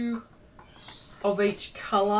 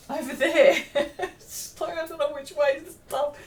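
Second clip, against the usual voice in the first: laughing; 8 semitones higher, 7 dB louder than usual. Real, not acted. Laughing at her messy room.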